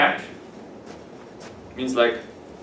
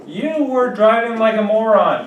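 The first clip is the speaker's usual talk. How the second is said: mock telling-off; 6 semitones higher than usual. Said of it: "it's kinda rude".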